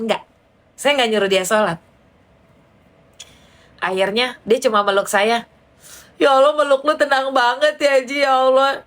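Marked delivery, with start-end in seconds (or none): 6.2-8.8 tearful friend. Retold or imitated. Crying friend comforted by a hug.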